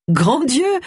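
high-pitched astonishment; startled exclamation. A narrator's reading. Hearing that Charles's fiancée is marrying, startled exclamation. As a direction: exclaim in sudden surprise.

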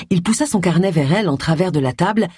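ordinary delivery

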